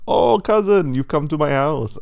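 high, loud theatrical welcome; effusive cousin pig. Acted. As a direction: greet a visiting cousin with a big exclamation. Cousin pig greets visitor at door.

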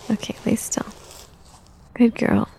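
hushed, barely voiced; soft whisper. Mother soothing her child during a diaper change, whispering. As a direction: whisper it gently so she stays still.

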